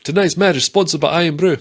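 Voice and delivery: loud, high sing-out sponsor read; mock Scottish announcer. Joking about Scottish commentary, loud high sponsor announcement. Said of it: "loads of Scottish mannerisms".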